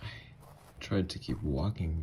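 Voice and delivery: ordinary talk, nothing marked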